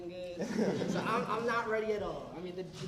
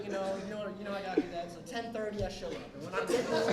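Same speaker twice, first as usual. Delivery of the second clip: ordinary delivery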